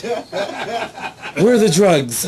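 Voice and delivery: drawn-out, effeminate interrogation; Catman voice. Acted. Catman interrogates someone he caught, slow effeminate voice. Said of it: "he does sound like this".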